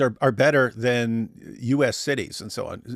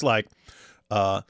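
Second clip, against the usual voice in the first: normal talking voice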